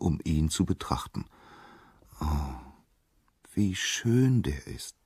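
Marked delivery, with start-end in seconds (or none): 2.2-5.0 slow, drawn-out sigh of admiration; dreamy, lingering admiration; admiring sigh. A narrator's reading. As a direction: sigh it out slowly, full of wonder. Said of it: "seufzten alle".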